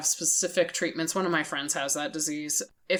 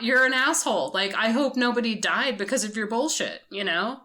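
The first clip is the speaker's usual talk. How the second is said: mock scolding; 6 semitones higher, 3 dB louder than usual. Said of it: "I'm like".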